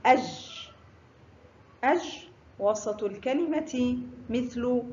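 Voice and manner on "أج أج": "أج" with no vowel after the j; sukun on jeem. Shown as a demonstration. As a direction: say "أج" ending on a sustained j sound. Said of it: "حرف الجيم فوقه سكون ينطق". (نطق "أج" بجيم ساكنة)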